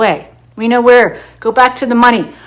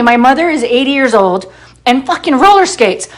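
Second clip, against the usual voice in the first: ordinary talk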